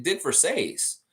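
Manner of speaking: Versace said as ver-SACE, rhyming with face; broadcast pronunciation. Shown as a demonstration. Host insisting on the coach's name as heard on TV, correctly pronounced. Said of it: "They said Dick Versace on the".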